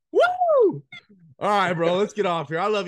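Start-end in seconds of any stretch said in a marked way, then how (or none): 0.1-0.8 loud, high celebratory woo. Real, not acted. Host whooping as the podcast wraps up, loud and high. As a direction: whoop it out in joy.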